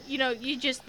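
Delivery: ordinary talk, nothing marked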